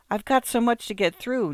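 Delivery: a mild note of desperation pushing up in pitch; rather desperately. A narrator's reading. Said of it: "Nola began rather desperately".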